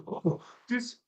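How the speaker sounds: put-on high, soft Russian kid accent. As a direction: lightly imitate a Russian kid's accent. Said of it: "he was a Russian kid".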